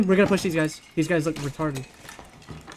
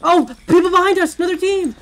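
alarmed callout; 11 semitones higher, 4 dB louder than usual. Real, not acted. Spots enemy team behind.

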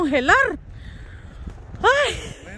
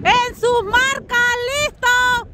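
higher, slow starting call; race starter's call; 4 semitones higher, much slower than usual. Real, not acted. Starter to sledders at the top, drawn-out race call. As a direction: stretch out the ready call, pitched up.

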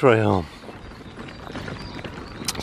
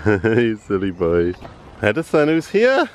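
usual speaking voice

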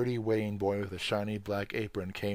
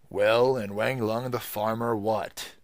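louder, slow, scornful gatekeeper; retort; 8 dB louder, slower than usual. Acted. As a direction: throw it back at him. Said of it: "retorted the gate man".

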